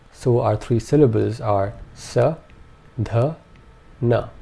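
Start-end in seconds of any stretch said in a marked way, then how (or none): 1.9-4.3 sa, dha, na separated with short vowels; syllable by syllable. Shown as a demonstration. Teacher splitting sadhana into short syllables. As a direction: break it into sa, dha, na. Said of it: "All three vowel sounds in this are short".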